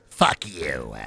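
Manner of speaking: mocking cartoon villain greeting Space Ghost; Zorak voice. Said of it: "Hate how good your Zorak is".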